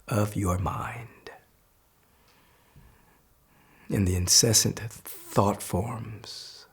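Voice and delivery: guided meditation. Acted.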